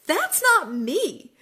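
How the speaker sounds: high, slow, laughing through "That's not me."; laughing repeat. Real, not acted. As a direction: laugh it out with relief.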